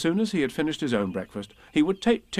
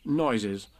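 plain reading voice

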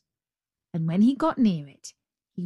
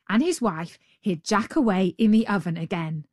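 plain reading voice

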